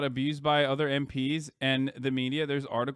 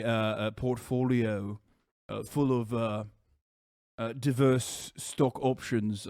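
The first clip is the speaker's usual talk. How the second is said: low, hesitant upper-class English impression; Jacob Rees-Mogg; 5 semitones lower than usual. Streamer impersonating Jacob Rees-Mogg on request, low halting voice. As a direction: murmur pompously, with plenty of ums. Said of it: "Can you do a Jacob Rees-Mogg".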